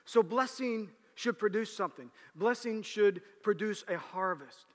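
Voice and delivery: high, loud preaching. Acted.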